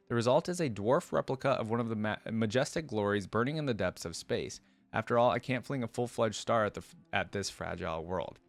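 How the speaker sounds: ordinary talk, nothing marked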